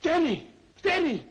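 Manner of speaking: high, loud alarmed calls of Denny; excited yelps for Denny's attention; startled call. Acted. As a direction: call out for help, spooked.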